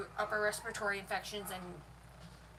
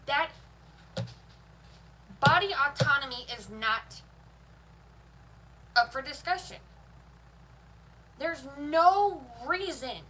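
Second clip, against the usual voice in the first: real furious, louder, hammering each no; heated and loud; 3 semitones higher, 5 dB louder than usual. Real, not acted. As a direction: slam the point down, angry and loud. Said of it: "I'm getting heated now".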